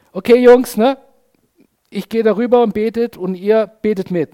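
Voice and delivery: put-on imagined Jesus briskly rallying the boys; loud, chummy instruction to the group; Jesus, mock casual. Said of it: "Könnt ihr euch Jesus vorstellen?".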